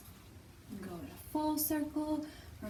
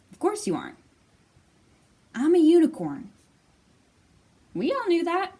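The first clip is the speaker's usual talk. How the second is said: mild amused friends; 5 semitones higher, 11 dB louder than usual. Acted. Narwhals reply.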